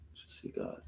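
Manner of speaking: breathy whispered echo. Real, not acted. Preacher echoing the verse to himself, whispered. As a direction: whisper it back to yourself in awe. Savoring the verse just read.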